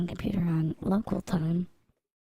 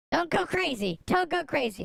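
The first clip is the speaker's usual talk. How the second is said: high-pitched, urgent exclamations; urgent warning; 8 semitones higher than usual. Real, not acted. Streamer telling chat he's not moderating, high urgent warning. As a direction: cry out in a high, playful panic.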